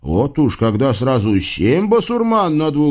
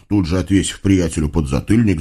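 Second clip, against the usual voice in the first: ordinary delivery, nothing marked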